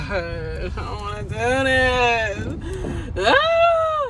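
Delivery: half-joking groan; laughing whine. Real, not acted. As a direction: drag it out through a laugh. Admits not wanting to work.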